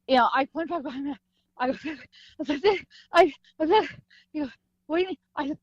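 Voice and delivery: acting winded, words broken by gasps; out of breath. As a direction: puff through the words after a hard run. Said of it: "I'm literally out of breath".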